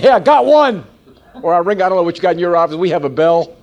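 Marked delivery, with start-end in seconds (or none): 0.0-0.8 high, loud triumphant yell; excited shout. Retold or imitated. Salesman shouting his win to the office, loud and high. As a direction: crow it out like you just closed the sale. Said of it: "I'm shouting".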